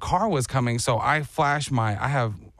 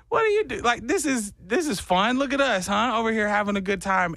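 put-on much higher, bouncy baby voice; high playful dog talk; 8 semitones higher than usual. Riding home chatting to the dog, high and playful. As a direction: chirp it up high to the dog in your arm.

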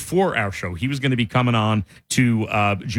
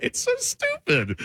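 high laughing, can't get through it; breaking up with laughter mid-joke; cracking up so hard the words squeak out high; 13 semitones higher, faster than usual. Real, not acted. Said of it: "I'm laughing already".

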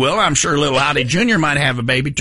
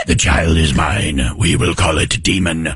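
put-on a father declaring his demon child's fate, low and ominous; ominous deep voice; 10 semitones lower than usual. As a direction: intone it like a doom prophet.

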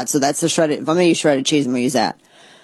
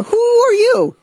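much higher and louder squeal of disbelief; mock disbelief; 5 dB louder, slower than usual. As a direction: scoff at them in a squeaky pitch. Mocking block cheese buyers.

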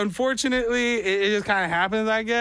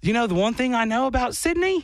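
higher mock Australian; fake Australian accent; 6 semitones higher than usual. Comic shares his Sydney knowledge, higher fake Australian accent. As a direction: carry on in bogus Australian. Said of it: "you can't stop".

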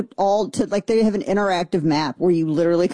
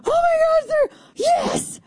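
very high, hammed-up cry of glee; mock excited squeal; slower than usual. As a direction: squeal like a fangirl who just got proof. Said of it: "And I'm so excited".

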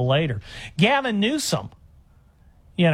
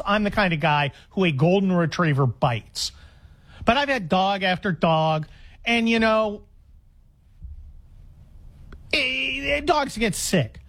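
ordinary talk, nothing marked

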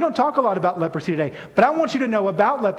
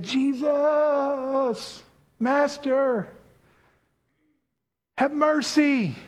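high, strained plea for mercy; lightly voiced desperate plea; raspy lepers pleading; 5 semitones higher, much slower than usual. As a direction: cry out to him from a distance. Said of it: "raised their voices as best as they could".

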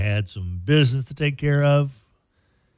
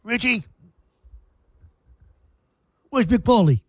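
higher, mob-style Italian-American accent calling out names; higher New Jersey Italian accent, Sopranos style; 10 semitones higher than usual. Shown as a demonstration. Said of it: "So there's my other Italian accent".

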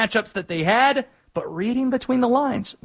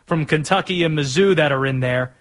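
ordinary delivery

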